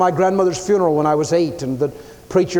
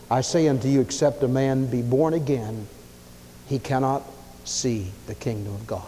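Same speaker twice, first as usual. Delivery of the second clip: solemn hushed Jesus; 4 semitones lower, 7 dB quieter than usual. Acted. Jesus solemnly answering Nicodemus.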